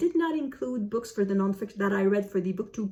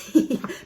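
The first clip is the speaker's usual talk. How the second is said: breathy laugh; 7 semitones higher, 6 dB louder than usual. Real, not acted. Laughs admitting she hasn't read it.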